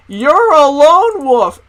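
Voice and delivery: high, loud, drawn-out call; loud sing-out in a mock teacher voice; mocking substitute. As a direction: crow it out slowly, imitating a teacher. Substitute's outburst mocked to class.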